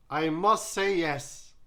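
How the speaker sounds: loud, high and slow; emphatic yes. Acted. Judge giving her vote, loud and deliberate. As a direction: lean hard on every word.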